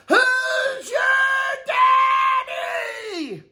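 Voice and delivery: high, slow, drawn-out victory yell; triumphant yell. Pretend. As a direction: roar it like a champion. Triumphant champion call.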